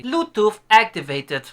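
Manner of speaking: slow, loud robotic speaker announcement; tinny gadget voice, drawn out; cheap speaker voice. Retold or imitated. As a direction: mimic a dodgy Bluetooth speaker. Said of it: "like when I buy a dodgy speaker".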